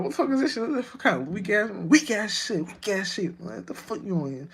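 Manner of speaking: mocking contempt that dies away; mock disgust. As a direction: sneer at the weak idea, then let it trail off. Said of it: "I'll be like".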